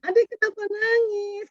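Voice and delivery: high, mock-pleading mother asking a crying child. Mother questions her crying child, high and pleading. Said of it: "Kan kata ibunya ya".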